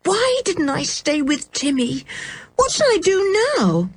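high, plaintive wail of worry; fretful dismay. A narrator's reading. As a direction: lament helplessly in a high child's voice. George frets over her dog.

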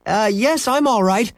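high-pitched mock shout; shouting back. A narrator's reading. Boy answering mother over the phone, mock shout. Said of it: "Henry shouted back".

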